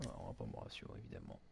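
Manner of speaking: whispered sarcastic aside; muttered aside. Real, not acted. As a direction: mutter it under your breath.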